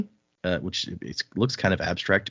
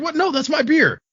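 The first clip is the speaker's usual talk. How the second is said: high, louder mock outrage over his beer; offended drunk; 5 dB louder than usual. Bar patron balks at losing his beer, high mock outrage. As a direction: squeal in indignant protest. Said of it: "And the guy's like".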